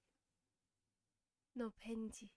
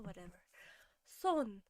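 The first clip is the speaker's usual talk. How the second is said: ordinary talk, nothing marked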